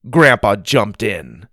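usual speaking voice

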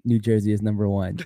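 ordinary talk

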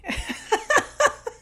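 high, real laughing fit; helpless high-pitched laugh; burst of laughter. Real, not acted. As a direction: crack up at what was just played. Reacting to the AI voice clip.

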